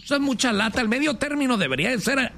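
high-pitched, exasperated complaint. Real, not acted. Host griping about waiting until midterm, exasperated.

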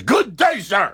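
high, exasperated dismissal; Willy Wonka impression. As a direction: snap it out like an angry candy maker. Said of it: "at the end where he says".

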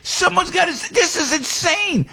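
high, exasperated shout of stop it; a real outburst, pitch way up. Real, not acted. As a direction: yell it out, fed up and high. Ranting at killers' trial demands.

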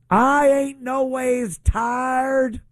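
high, slow, drawn-out mock Southern preacher accent; mock Hillary accent. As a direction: stretch it out like a put-on Southern church accent. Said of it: "Hillary Clinton".

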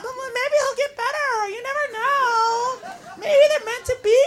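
high, soft wishful voice; sweetly naive girl voice; hopeful girlfriend. Acted. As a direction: timidly make excuses for a couple. Girl hopefully defends couple.

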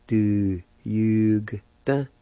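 du jugte said with rising stress on jugte; join the parts. Shown as a demonstration. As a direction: blend du jugte together. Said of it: "And together".

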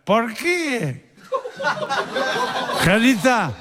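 high, put-on old man calling out; drunk father-in-law. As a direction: play a tipsy father-in-law calling his daughter-in-law. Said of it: "Le dice el suegro".

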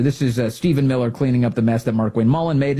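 ordinary talk

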